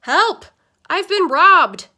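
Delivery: loud, high panicked cry, slowing at the end; old man bellowing for help; shouting for help. Acted. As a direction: yell it up the stairs like he's been robbed. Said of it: "We heard Grandpa shouting".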